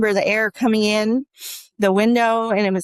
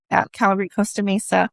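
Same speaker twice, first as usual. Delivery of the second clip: usual speaking voice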